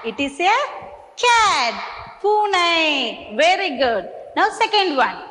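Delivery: usual speaking voice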